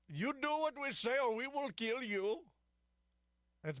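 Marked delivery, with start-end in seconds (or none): 0.1-2.4 high-pitched mock Iranian accent; Pezeshkian in mock Iranian accent. Radio host parodying Iran's government threatening people, in a mock Iranian accent. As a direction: put on a hammed-up Iranian accent, pitched well up. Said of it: "if he actually speaks in that accent".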